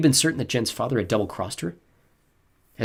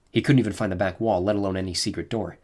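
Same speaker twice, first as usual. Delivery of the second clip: ordinary delivery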